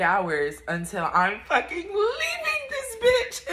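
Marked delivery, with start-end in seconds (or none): 1.5-3.5 laugh-crying. Real, not acted. Emotional about leaving.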